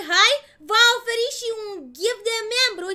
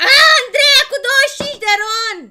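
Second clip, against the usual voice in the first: high loud delighted call; loud excited announcement; 6 semitones higher, 12 dB louder than usual. Real, not acted. Streamer excited to read Andreea's donation, loud. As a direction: shout out the donation.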